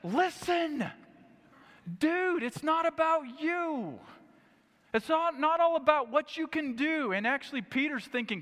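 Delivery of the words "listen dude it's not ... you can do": higher, slow stern rebuke; a lightly voiced God scolding Peter; rebuking God. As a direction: set him straight from above.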